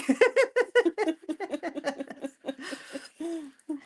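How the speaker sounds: long, high giggling laugh; helpless high-pitched giggles; real laughter. Real, not acted. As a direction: crack up at your own joke. Laughs at own lost-egg joke.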